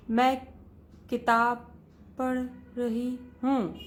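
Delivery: "मैं", "किताब", "पढ़", "रही हूँ" split by long pauses, the end slow; uneven wrong reading. Shown as a demonstration. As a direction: stumble through the words one by one. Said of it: "ऐसे नहीं". (रुक-रुक कर, असंतुलित गति से पढ़ना)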